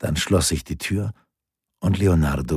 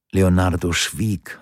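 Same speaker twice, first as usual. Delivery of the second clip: usual speaking voice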